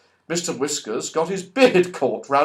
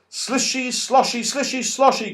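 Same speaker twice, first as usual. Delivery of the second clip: high sing-song sloshing words; splashing noise; 10 semitones higher than usual. Pretend. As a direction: imitate the slosh of washing. Washing sounds imitated.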